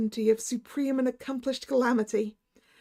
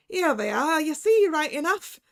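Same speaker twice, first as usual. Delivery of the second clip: Irish doctor; 3 dB louder than usual. Acted. Irish doctor showing the statues, cheerful Irish. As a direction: show him, cheerful Irish.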